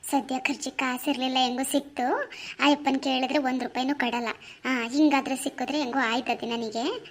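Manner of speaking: softly, to herself, pleased with the find; a sly murmur to herself; quiet scheming aside. Acted. Wife hiding the found money, quietly to herself.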